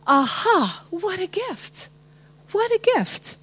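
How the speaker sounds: chuckling at a wonderful surprise; amused delight. Retold or imitated. Believer laughing at life's gift, lightly amused. Voicing delighted humor at grace.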